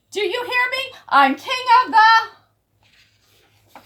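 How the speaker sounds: high, loud slow laughing boast; gloating laugh. Acted. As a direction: cackle over the crowd. Big Bark gloating, laughing.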